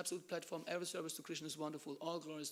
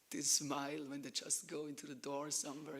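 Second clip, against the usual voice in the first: ordinary talk, nothing marked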